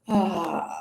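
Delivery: a long sighing ah as the chin comes down; exhaled ah. Shown as a demonstration. Instructor showing the class the exhale sound, sighing ah. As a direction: let the breath out on an open ah. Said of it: "If you wanna make a sound like a ah".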